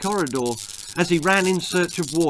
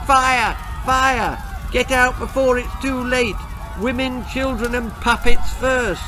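higher, louder panicked shouting; 8 semitones higher, 4 dB louder than usual. Acted. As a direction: yell the alarm while fleeing.

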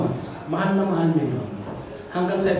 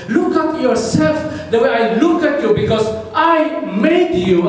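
high, loud, emphatic preaching; God's impassioned declaration; 9 semitones higher, 5 dB louder than usual. Acted. Preacher voicing God to the congregation, fervently. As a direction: proclaim it with raised, ringing force. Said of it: "Ang sabi ng Diyos".

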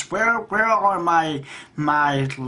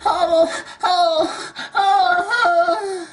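very high, louder drawn-out "oh"s; hammed-up worshipful wail on one high note; mock submissive moaning; 4 dB louder, much slower than usual. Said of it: "They drop to their knees".

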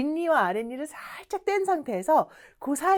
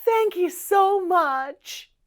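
gasping, high, slow thanks; hammed-up gratitude; overflowing gratitude; 7 semitones higher, much slower than usual. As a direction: gush with heartfelt, drawn-out thanks. Acting out grateful person for practice.

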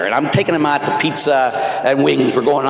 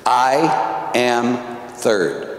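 slow; 2 semitones lower, much slower than usual. Acted. Quoting mirror words.

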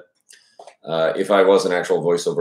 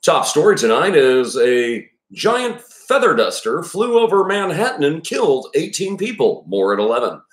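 high, sing-song TV newscaster voice; news anchor; 7 semitones higher than usual. Shown as a demonstration. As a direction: announce it like the evening news, stretching key words. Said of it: "what you would hear on the news".

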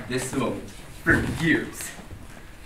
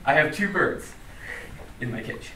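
ordinary talk, nothing marked

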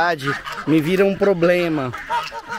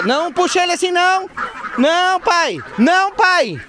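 yelling; 13 semitones higher than usual. Real, not acted. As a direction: shout at him to stop. Yelling at father pulling emu.

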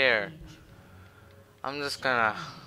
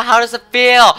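high, loud mocking question; gloating loud question; loud taunt; 10 semitones higher, 9 dB louder, faster than usual. Real, not acted. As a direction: taunt the dead enemy. Taunting dead enemy.

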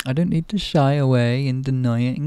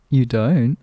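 ordinary talk

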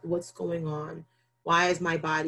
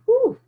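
high, loud whew of being overwhelmed; loud shaky whew; overwhelmed exhale; 10 dB louder than usual. Retold or imitated. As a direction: blow it out like you're about to break. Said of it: "I'm just like".

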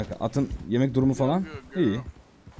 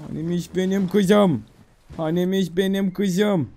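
high, slow, doting coo; baby talk; 4 semitones higher, slower than usual. Pretend. Player petting the tamed horse, drawn-out baby talk. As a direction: coo as if to a little girl.

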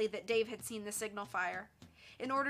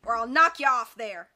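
loud, threatening villain; sharp threat; 12 dB louder than usual. A narrator's reading. Villain threatens Nancy on the jutting rock, loud and sharp. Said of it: "the man repeated sharply".

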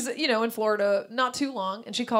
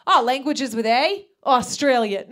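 imitating a proud mom, loud and raised; proud announcing; 3 semitones higher, 8 dB louder than usual. Mom reads out her word-game answer, loud and proud. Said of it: "My mother proudly reads her answers".

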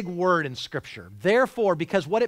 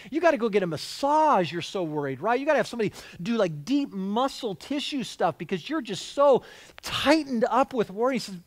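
ordinary talk

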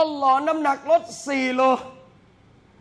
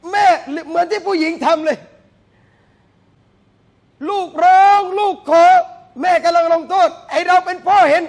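loud, raised preaching voice, almost shouting; the preacher rouses the congregation, loud and fervent; fiery preaching; 5 semitones higher, 8 dB louder than usual. Acted. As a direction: preach it to the crowd at full force. Preaching builds up again.

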